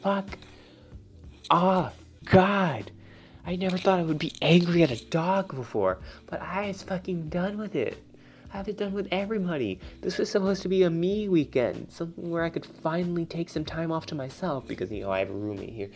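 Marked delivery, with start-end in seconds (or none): 0.0-2.9 high, loud fed-up outburst; exasperated yell. Retold or imitated. Yelling at the loud beagle puppy in exasperation. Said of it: "and I'm like". 3.4-14.7 fed-up rant. Real, not acted. Venting about noisy weekend.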